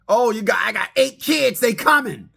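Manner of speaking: mock the date's boast; a hint of a sassy woman. Voicing a woman on a date.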